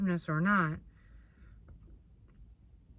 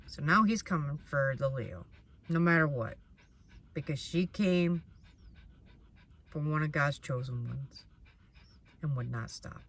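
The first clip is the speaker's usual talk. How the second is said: ordinary delivery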